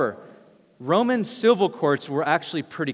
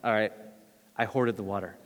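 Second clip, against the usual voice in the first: low, soft, guilty admission with a laugh; sheepish confession; 9 semitones lower, 8 dB quieter than usual. Retold or imitated. Friend owning up to the speaker about hoarding water, sheepish. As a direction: confess it quietly, caught out. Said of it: "He's like".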